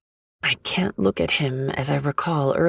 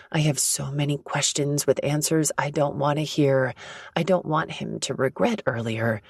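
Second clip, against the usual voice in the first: usual speaking voice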